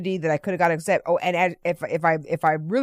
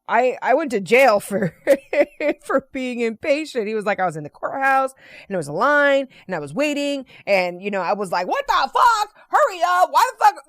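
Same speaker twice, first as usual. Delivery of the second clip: higher, louder, building to a mock rant; animated retelling; 6 semitones higher, 3 dB louder than usual. Man in line retells his courthouse rant, climbing to a mock yell. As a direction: ramp it up to an exasperated yell.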